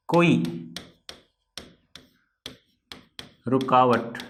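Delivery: word by word with long pauses; slow dictation. Shown as a demonstration. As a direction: dictate slowly for notes. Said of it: "तो क्या कहा मैंने?".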